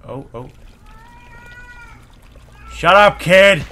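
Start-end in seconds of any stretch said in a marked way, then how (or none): 2.7-3.6 sudden higher, much louder snap; yelling. Real, not acted. Player to a noisy kid in the game, irritated yell. Yells at crying game baby.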